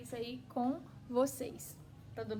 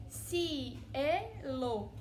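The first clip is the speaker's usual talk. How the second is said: cielo drawn out very slowly; teacher slowing down on a Spanish word; slow syllable demo; 3 semitones higher, 4 dB louder than usual. Shown as a demonstration. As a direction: say cielo slowly, each sound clear. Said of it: "O U e o A não separa".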